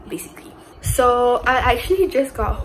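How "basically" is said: breathy little whisper tacked on; hushed throwaway aside. Real, not acted. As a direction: drop to a secret whisper for the last word. Whispered aside closing the thought.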